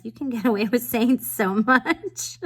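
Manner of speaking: amused, laughing out loud. Real, not acted. Laughing about getting away with it.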